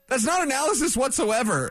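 normal talking voice

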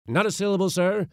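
loud, high interruption; stern silencing. A narrator's reading. Uncle refusing to hear his nephew's excuse, stern. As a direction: shut the plea down forcefully.

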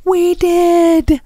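put-on slow drawn-out kids' whine; exasperated kids. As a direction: drag it out like fed-up kids. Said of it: "They're like".

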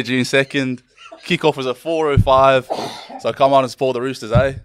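put-on Yorkshireman putting on a broad Australian accent, laughing through it; mock Aussie accent. English player reading the ad in an Australian accent. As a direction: ham up a light Aussie twang. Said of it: "adapt this to an Aussie".

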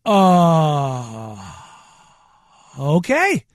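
drawn-out, stunned hesitation; long stalled "uh", then a tentative okay; shocked. Retold or imitated. Customer stunned by the cashier's accent, shocked and hesitant. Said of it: "I was in shock".

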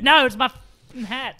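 high, slow mock protest; mock indignant. Retelling her fight over the hat, mock indignant. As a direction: protest slowly as the imitated hat owner. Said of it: "She's like".

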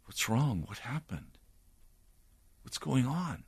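lightly voiced worried wife, soft and hushed; concerned wife. Preacher voicing his late wife finding him overwhelmed, soft worry. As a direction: ask gently, hushed and anxious. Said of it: "My wife was very concerned".

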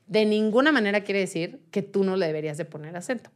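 normal talking voice

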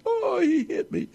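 put-on very high whining child; toddler tattling. Imitating a toddler telling on another, very high. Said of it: "the terrible twos".

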